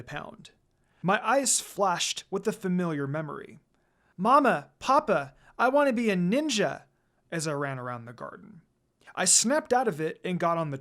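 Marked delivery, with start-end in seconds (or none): 4.2-6.8 lightly voiced small child, a bit louder; a hint of an eager kid calling to her parents; excited little child. A narrator's reading. Girl remembering herself as a child telling her parents, lightly childlike.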